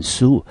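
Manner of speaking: "sue" sounded out by itself; "sue" on its own, cleanly separated; second syllable alone. Shown as a demonstration. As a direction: isolate "sue" as a teacher's model syllable. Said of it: "第二个音节念成".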